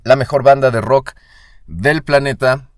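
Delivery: loud, punchy show intro; podcast announcer. Acted. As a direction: announce the show like a radio host.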